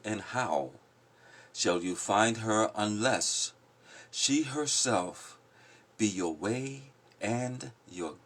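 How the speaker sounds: slow, measured poetry recital; poem reading. Acted. Reading The Prophet aloud to the group, slow poetic recital. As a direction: lift each line like verse read aloud.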